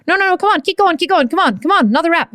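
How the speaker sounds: high, pushy coach voice egging on; high-pitched trainer cheering her on; urging coach. Retold or imitated. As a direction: spur her through another rep.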